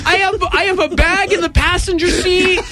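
put-on high, childish protest at God; whiny complaint. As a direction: whine like a kid refusing. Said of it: "angry with God in the moment".

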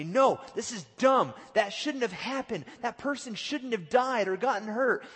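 high, loud mock tantrum; hammed-up indignant outburst; acted childish outrage. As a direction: protest to mom like an upset kid. Voices himself as protesting child.

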